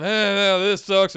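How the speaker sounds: dismissive grumble of the smarks; mock whiny complaint. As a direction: scoff it like a jaded fan. Mocking smarky fans' complaints.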